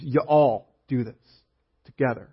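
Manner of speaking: slow, drawn-out "y'all do this together"; Southern y'all. Shown as a demonstration. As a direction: stretch "y'all" slowly, Southern style.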